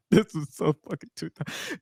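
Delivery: talking through laughter; helpless amusement at something absurd; real laughing fit. Real, not acted. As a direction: crack up while getting the words out. Cracking up at dated joke.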